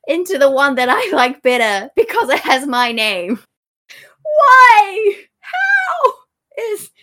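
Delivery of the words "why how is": cracking, shouting disbelief, pitched way up; a real outburst of disbelief, half-crying; exasperated shout. Real, not acted. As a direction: throw up your hands and yell it. S1 ranting about the grandpa.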